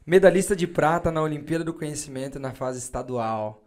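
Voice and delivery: slow, loud announcer build-up; announcer intro. Acted. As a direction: hype up the guest like a presenter. Hype introduction of guest.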